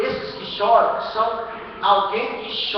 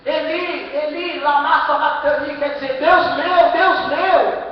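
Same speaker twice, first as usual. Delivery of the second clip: high, loud cry to heaven; Jesus crying out; 6 semitones higher, 7 dB louder, slower than usual. Acted. Preacher voicing the crucified Jesus calling on God, loud cry. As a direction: call out to God with full force. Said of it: "o que que ele fez? Ele disse".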